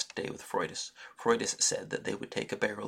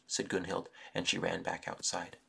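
ordinary delivery, nothing marked

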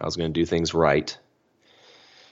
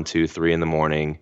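usual speaking voice

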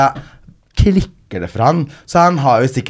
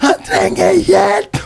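frantic yelling; 12 semitones higher, much slower than usual. Retold or imitated. Stuck in plastic, yelling for help for the man beside her. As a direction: yell for someone to come help, half laughing. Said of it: "og hyler".

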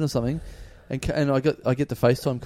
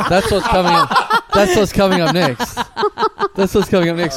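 mock announcer; 5 semitones higher, 6 dB louder than usual. Teaser announcer, laughing.

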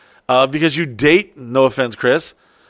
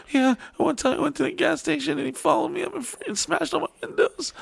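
much higher, a mocking girly voice; whiny girlfriend; 7 dB quieter than usual. Girlfriend telling him she has no pants, mock whiny. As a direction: imitate her plaintive excuse. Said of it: "she'd be like".

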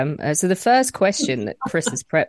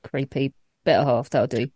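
normal talking voice

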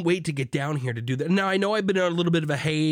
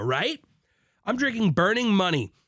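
ordinary talk

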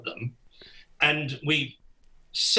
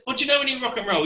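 very fast, pitched-up question; rapid pitched-up plea; frantic question; 10 semitones higher, much faster than usual. Retold or imitated. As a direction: fire it off in a panic. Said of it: "I said".

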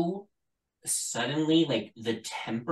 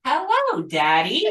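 high squeaky talking doll voice; pull string toy; 12 semitones higher, 6 dB louder than usual. Pretend. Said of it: "and the doll goes".

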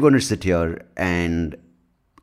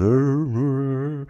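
doctor's mock grunt; 8 semitones higher than usual. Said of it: "he goes".

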